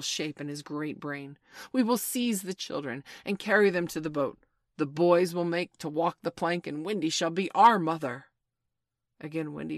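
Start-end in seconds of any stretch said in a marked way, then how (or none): 1.7-8.3 loud, emphatic proclamation; commanding. A narrator's reading. Hook giving his plan to the pirates, commanding. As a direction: lay out the plan forcefully to the crew.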